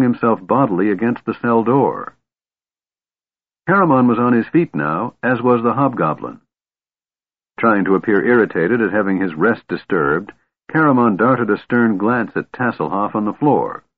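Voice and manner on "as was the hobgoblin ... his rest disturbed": usual speaking voice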